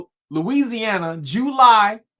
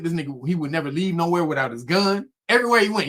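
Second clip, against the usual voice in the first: normal talking voice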